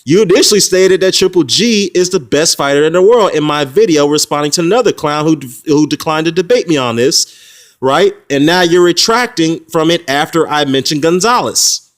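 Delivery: fired-up quote of himself; heated quoting. Retold or imitated. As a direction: pitch it up high and insistent, arguing the point.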